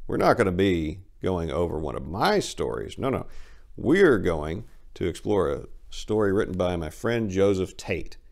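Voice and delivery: plain reading voice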